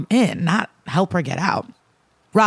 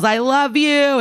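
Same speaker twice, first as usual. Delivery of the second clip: mock yell; 8 semitones higher, slower than usual. Mother yelling to her daughter as she's dragged away, mock yell. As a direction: imitate a yell, pitch well up. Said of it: "And she yells".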